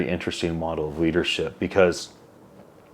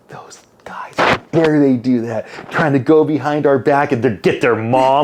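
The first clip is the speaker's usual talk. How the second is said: hissed indignant whisper turning louder; mock whispered outrage; 6 semitones higher, 4 dB louder than usual. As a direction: play the jealous disciples, scandalized. Angry disciples voiced in story.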